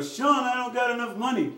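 high, whiny imitation of a broke young guy; imitating a complaining young man. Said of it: "it's always going".

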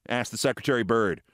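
ordinary delivery

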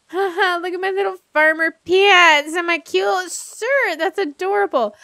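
high giddy voice; delighted and pleased with herself. Real, not acted. Admiring her own outfit.

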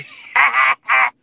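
loud laugh breaking into a cry; emotional laugh. Real, not acted. Man overjoyed about queen, laughing loud through tears. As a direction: laugh out loud, half crying.

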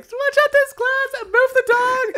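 very high, slow hammed-up shriek; mock panicked yelling. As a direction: shriek orders in imitated chaos. Re-enacts panicked group orders.

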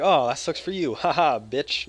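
loud gloating jeer with a laugh; mocking taunt. Gamer mocks his friend over the cape, loud gloating jeer. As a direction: rub it in with a cackle. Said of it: "No, I'm just kidding. I didn't say that".

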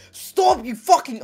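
high furious shout at the game; angry yell. Real, not acted. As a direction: snap loudly at the enemy. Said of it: "you tell me not to yell".

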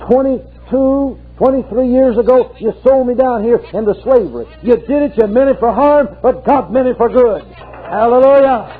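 high, ringing preacher's cry; fervent revival preaching; preaching at full force. Acted. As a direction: preach it out, lifting the congregation. Preacher voices Joseph at full pitch.

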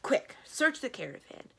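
urgent order. A narrator's reading. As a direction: order them to get searching at once. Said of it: "ordered Ahriman".

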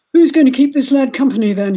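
loud bellowing sergeant. A narrator's reading. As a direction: bellow it to the crowd. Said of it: "he bellowed".

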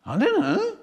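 high, squeaky don't-know; high squeaky reply. Retold or imitated. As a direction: voice jumps way up, squeaky and helpless. Grieving friend answers.

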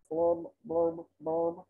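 low, soft blurb sounds; glum bubble blurbs. Acted. Pouting fish in the ocean, low glum blurbs. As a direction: sink into sad bubbles.

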